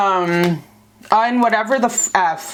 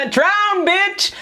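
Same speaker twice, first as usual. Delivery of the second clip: hammed-up furious yell, higher; hammed-up rage, pitched up; mock rage; 9 semitones higher than usual. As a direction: mock-scream at him in the tub. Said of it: "That kind of rage".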